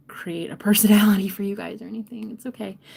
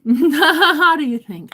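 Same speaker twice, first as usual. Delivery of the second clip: loud, high laugh drawn out into the question; slow, laughing question; 8 semitones higher, 10 dB louder, much slower than usual. Real, not acted. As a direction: burst out laughing as you ask. Laughing while asking for feedback.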